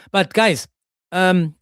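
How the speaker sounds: usual speaking voice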